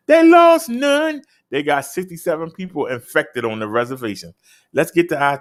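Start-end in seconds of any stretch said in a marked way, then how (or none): 0.1-1.2 high, loud, slow "lost none" called out; triumphant shout. Acted. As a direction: shout the good news like a winner. Triumphant punchline in stats report.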